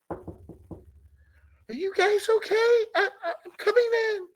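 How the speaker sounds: a worried woman's falsetto calling through the door; Sally calling in. Retold or imitated. Sally calling through the door to the men, high and fretful.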